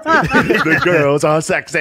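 high, slow gleeful imitation; mock excited boast. Imitating Sam telling his boys at the show, high drawn-out glee. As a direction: draw it out high, laughing along. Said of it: "they show his face like".

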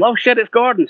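high surprised realization; startled, pitch jumping up. Retold or imitated. Seeing his old bandmate calling, high surprised.

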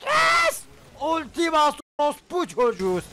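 high triumphant yell; excited yell. Real, not acted. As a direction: burst out cheering the win. Big win in game. (heyecanlı zafer çığlığı)

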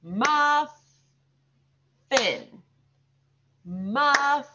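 muffin with a long gap between syllables; clap-along "Muffin", each syllable held high; broken into syllables. Shown as a demonstration. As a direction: pause after muf, then finish the word. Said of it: "We'll throw the claps in there too".

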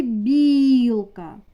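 "білка" with a long, loud І; виділений звук і. Shown as a demonstration. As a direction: stretch and punch the І in "білка". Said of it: "Звук і". (протяжне, гучне І у слові "білка")

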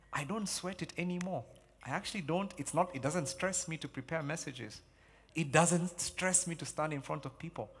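ordinary delivery

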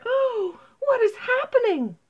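very high, loud startled cry; alarmed cry. A narrator's reading. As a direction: cry out in sudden alarm, voice leaping up. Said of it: "she cried".

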